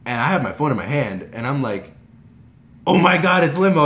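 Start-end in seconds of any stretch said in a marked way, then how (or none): 2.9-3.8 loud, high fangirl burst; freaking out. Retold or imitated. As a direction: blurt it out loudly, starstruck. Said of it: "I freaked out".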